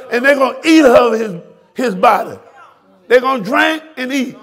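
fiery sermon shout; preaching at full force. Real, not acted. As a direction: drive the rebuke home from the pulpit.

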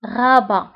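غابة as French ra-ba, uvular r, no t; French Rabat in غابة, final t left silent. Shown as a demonstration. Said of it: "ولكن لا تنطق".

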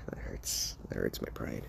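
hushed, breathy mock pain under the breath; wounded whisper. All Might, hurt by his son, whispers in mock pain. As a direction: whisper it like a hero stung by his son's words. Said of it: "All Might's like".

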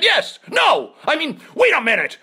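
high, loud flustered backpedal; flustered upset shout. Retold or imitated. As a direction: yelp out a panicked correction. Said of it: "And I got all upset".

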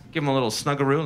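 normal talking voice

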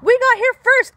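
loud high mock growl; kinkajou growls. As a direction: defend the spot loudly. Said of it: "Kinkajou growls".

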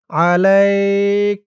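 আলাইক very slow, long vowel, stop on the ক; stopping recitation. Shown as a demonstration. Teacher showing where to pause, slow drawn আলাইক. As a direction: stretch আলাইক and close on a silent ক. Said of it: "এভাবে বলে থামবেন".

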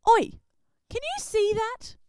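high, loud call across the water; a loud startled cry from a disciple; yelled out. Acted. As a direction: shout to the others in the boat. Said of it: "one of the disciples yelled out".

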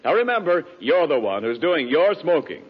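ordinary delivery

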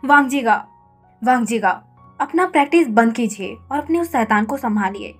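loud exasperated shout across the house. Acted. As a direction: yell for him to come deal with the kid. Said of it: "wing home में चिल्ला रहा था". (झल्लाकर ज़ोर से पुकारना)

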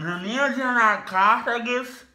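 words mangled by a mouth opener; mouth propped open. Real, not acted. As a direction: speak with your mouth held wide open. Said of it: "vou tentar falar com o abridor de boca".

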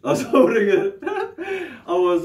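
words breaking into real laughter; laughing. Real, not acted. Man laughing at his angry itch, laughing through the words. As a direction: laugh through it, high and giddy.

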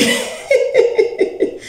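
airy high-pitched burst of laughter; breathless high laugh. Real, not acted. Laughing at herself after calling herself a pro, airy and high. Laughs at her own boast.

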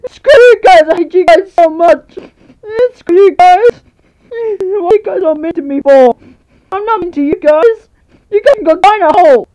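high, loud tearful wail; crying outburst. Acted. Bullied unicorn yells at bullies through tears. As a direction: yell through sobs at the bullies.

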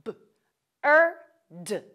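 B, er, d sounded out one at a time; segmenting bird sound by sound; tapped sounds. Shown as a demonstration. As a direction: say "B", "er", "d" as isolated sounds, "er" held. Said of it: "let's tap out those sounds".

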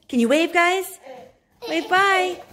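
high loud coaxing; cheerful urging. Real, not acted. Mom urging the kids to wave goodbye.

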